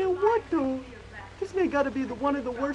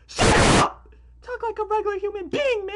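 exasperated shout; 7 semitones higher, 6 dB louder than usual. Real, not acted. Telling the speechmaker to stop.

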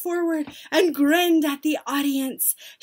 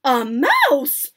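louder crowd of witches exclaiming; astonished cry; 7 dB louder than usual. A narrator's reading. Witches react to their leader's reveal, astonished. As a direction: cry out in acted amazement. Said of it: "cried the witches".

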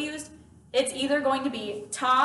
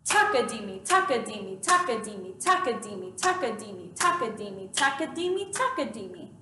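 Takadimi chanted as four quick, even syllables per beat; rhythm syllable demo; 4 semitones lower than usual. Shown as a demonstration. Teacher says takadimi over a clapped beat, even rhythmic chant. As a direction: rattle off Takadimi in strict repeating time. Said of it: "If I clap the beat and say".

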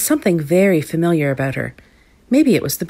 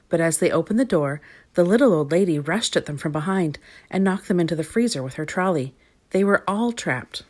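usual speaking voice

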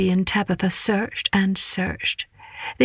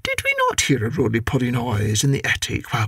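lower, brisk no-nonsense cat; stern neighbour cat; 7 semitones lower than usual. Acted. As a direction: scold like a stern aunt.

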